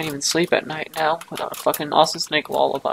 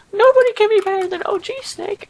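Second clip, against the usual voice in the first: much higher, louder mock-friend voice; 8 dB louder than usual. Friends insisting OG Snake is better, mocking high voice. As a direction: squeak it like an annoying buddy. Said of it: "and then they're like".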